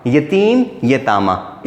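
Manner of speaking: यतीम यतामा said slowly, spelled out; slow teacher's dictation of यतामा; careful dictation. Shown as a demonstration. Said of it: "इसको यतामी नहीं पढ़ेंगे".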